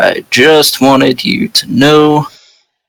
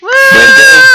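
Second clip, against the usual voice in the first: high, loud comic shout; mock shout; 5 dB louder than usual. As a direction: bellow it in a silly voice. Player shouts in put-on voice.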